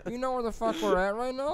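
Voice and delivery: mock outrage. Retold snapping at DMV lady.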